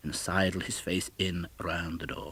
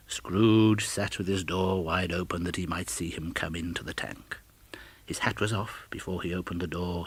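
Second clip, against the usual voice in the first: ordinary delivery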